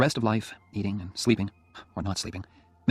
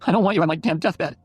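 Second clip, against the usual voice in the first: higher and louder, still fuming; angry; 8 semitones higher, 6 dB louder than usual. A narrator's reading. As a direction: keep the angry flare going. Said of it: "she said angrily".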